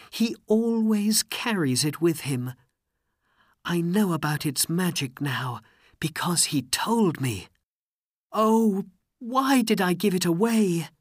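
high, upset princess voice; regretful princess. A narrator's reading. Princess regrets giving away the lamp, upset. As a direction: lament it in a high voice.

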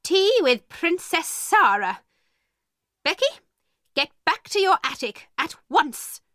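high-pitched, scornful scolding; angry. A narrator's reading. Miss Minchin ordering Becky back to the attic, angry. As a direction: scold them and order her out. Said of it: "she said angrily".

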